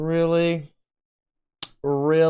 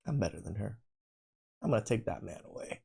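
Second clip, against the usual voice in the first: catty woman; 6 semitones lower, 13 dB quieter, faster than usual. Retold or imitated. Mocking jealous women.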